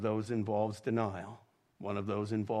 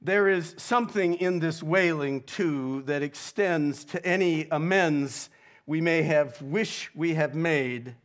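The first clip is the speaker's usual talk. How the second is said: louder and higher, pressing each phrase; preacher at the height of the sermon; full-force preaching; 4 semitones higher, 5 dB louder than usual. Acted. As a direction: drive it home to the congregation.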